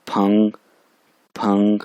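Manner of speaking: फंग with फा and अंग run together, rising; blended initial and final. Shown as a demonstration. Teacher showing both sounds in फंग, blended pronunciation. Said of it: "फा की आवाज़ भी आ रही है".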